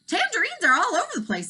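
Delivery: put-on boy voice. A narrator's reading. Elmer speaks.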